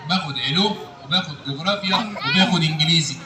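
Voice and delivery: very low puppet character voice; Aragoz puppet. Acted. Puppet telling the host his school subjects, Aragoz voice. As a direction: list it as a puppet, far below your own pitch. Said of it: "الأراجوز ده وهو بيرغي".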